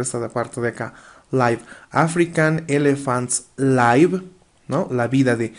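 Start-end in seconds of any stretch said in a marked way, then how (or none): 1.3-1.6 "Live" said with an eye sound, "laiv"; corrected pronunciation. Shown as a demonstration. Teacher repeats the correction to students, "Live" as "laiv". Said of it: "me equivoqué con la pronunciación". 1.9-4.2 slow reading, "live" rhyming with "five"; corrected pronunciation. Shown as a demonstration. Teacher rereads the elephant sentence, "live" as "laiv". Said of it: "me equivoqué con la pronunciación".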